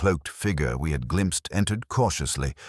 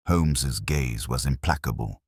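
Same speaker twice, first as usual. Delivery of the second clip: usual speaking voice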